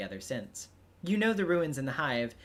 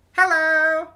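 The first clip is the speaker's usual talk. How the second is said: high, loud, bubbly greeting; bubbly shouted hello; 15 dB louder than usual. Acted. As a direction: chirp it out like an eager girl. Troll girl greets friend loudly.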